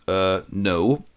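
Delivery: low, deadpan ghostly reply. Acted. The ghost answers Scrooge's plea, deadpan.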